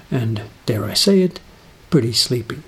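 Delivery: normal talking voice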